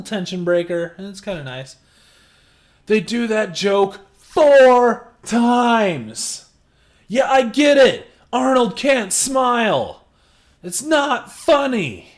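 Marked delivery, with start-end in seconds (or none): 2.9-12.1 loud, high exasperated rant; worked up, pitched high and loud. Real, not acted. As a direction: raise your voice, fed up with the joke. Exasperated rant about repeated joke.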